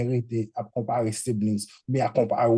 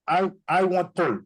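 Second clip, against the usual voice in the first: imitating the child; 4 semitones higher than usual. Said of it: "just like that, right?".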